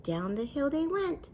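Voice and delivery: usual speaking voice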